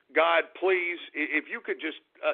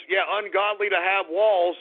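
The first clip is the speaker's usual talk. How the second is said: usual speaking voice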